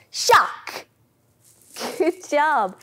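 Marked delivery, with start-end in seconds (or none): 0.1-0.9 higher, louder snap of a word; loud shark. Shown as a demonstration. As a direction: bite it out like a shark. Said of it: "big loud shark".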